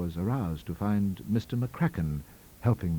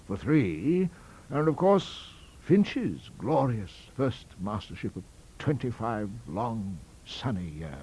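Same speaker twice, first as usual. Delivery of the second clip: higher voice, slowly savouring each name; complacent old academic; 7 semitones higher, 3 dB louder than usual. A narrator's reading. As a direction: reminisce fondly through a roll of predecessors.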